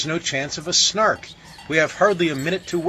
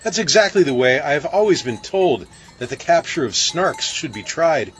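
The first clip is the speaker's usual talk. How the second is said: a touch louder and higher; keen agreement; eager cry; 5 semitones higher, 4 dB louder than usual. A narrator's reading. Bellman agreeing with uncle's advice, mildly excited.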